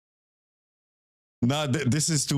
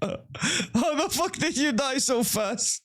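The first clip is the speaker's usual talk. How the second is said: laughing; 10 semitones higher, slower than usual. Real, not acted. Friend died instantly.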